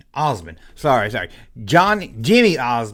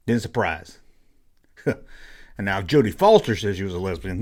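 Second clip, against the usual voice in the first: ordinary delivery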